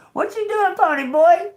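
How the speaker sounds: very high, loud teasing call; playful squeal. Real, not acted. Reader teasing pony boy, high playful squeal. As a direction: sing out the question high and loud.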